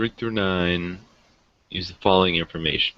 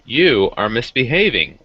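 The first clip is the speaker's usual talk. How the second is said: playful scold, higher and louder; scolding a pet; 5 semitones higher, 5 dB louder than usual. Real, not acted. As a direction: tell off the misbehaving cat. Scolding the cat.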